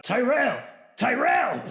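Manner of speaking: high calling of a servant's name. Acted. Marquis calling his butler across the hall, calling out. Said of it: "he calls out".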